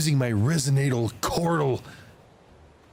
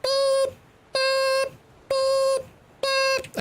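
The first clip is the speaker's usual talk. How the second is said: high, even boops like a reversing truck; beeper imitation; much slower than usual. Shown as a demonstration. Streamer imitating a truck's reversing beeper, high mechanical boops. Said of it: "electronic beeper things when I'm backing up".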